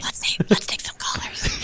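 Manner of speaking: hushed, voiceless whisper. Pretend. Co-host suggesting they take callers on the show, whispering. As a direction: whisper it like a secret. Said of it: "Why are we whispering?".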